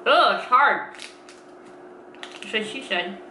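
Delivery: teeth clamped on food; words through a bite; speaking while biting bar. Real, not acted.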